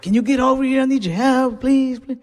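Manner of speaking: high-pitched imitation of a crying cousin; comic retelling of someone pleading through tears; mock tearful plea. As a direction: beg in a high, weepy voice, hammed up. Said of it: "She was crying".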